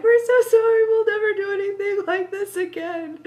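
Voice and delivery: high squealed mock-terrified apology; panicked apology. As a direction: beg forgiveness like scared frat boys. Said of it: "the frat boys are like".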